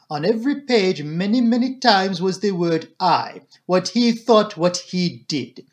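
measured, emphatic Belgian detective voice; emphatic Poirot. Acted. Detective comparing the doctor's account to Hastings's, emphatic.

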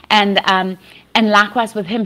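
usual speaking voice

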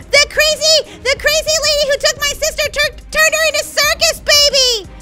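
high, loud, stumbling outburst; hysterical panic. Acted. Girl seeing sister turned animatronic, hysterical shouting. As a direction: blurt it out in horror.

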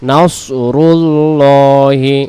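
drawn-out নাসরুল্লাহি chanted; slow recitation. Shown as a demonstration. Teacher chanting the corrected word slowly. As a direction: sing it long and even. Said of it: "উচ্চারণ হবে".

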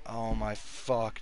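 normal talking voice

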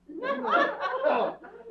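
amused chuckle; a quick wry laugh; short high laugh. Real, not acted. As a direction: tramp amused by the question. Laughs at whiskers question.

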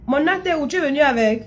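high, loud call across the room; shouting to someone in another room. Real, not acted. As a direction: call to him from a distance. Calling to someone off-camera.

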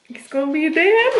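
high, emotional rising voice; tearing up as the pitch climbs; near tears. Real, not acted. Expectant wife overwhelmed about the coming baby, near tears. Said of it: "I'm crying already".

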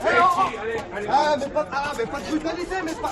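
mock protest. Prisoner protesting the rough police, hammed-up outrage. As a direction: cry out in hammed-up indignation.

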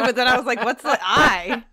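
loud, laughing retelling; amused loud chuckling. Real, not acted. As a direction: laugh through the line. Laughing at the joke.

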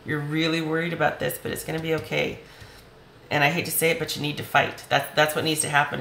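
normal talking voice

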